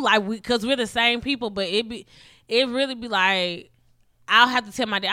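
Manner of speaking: normal talking voice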